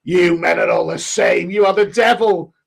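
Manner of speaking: hammed-up furious nun telling men off; imagined outraged nun. Imagined nun scolding him.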